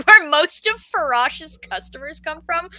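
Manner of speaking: normal talking voice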